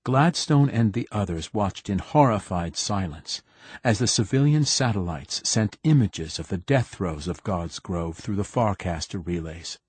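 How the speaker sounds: usual speaking voice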